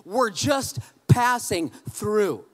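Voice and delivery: "just passing through" very slow and loud, word by word; "We're just passing through" stretched out and projected; emphatic title statement. Real, not acted. Announcing the title of the message.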